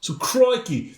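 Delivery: high, put-on Australian accent. Co-host told the accent is missing, mock Australian accent. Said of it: "I'm not hearing the accent".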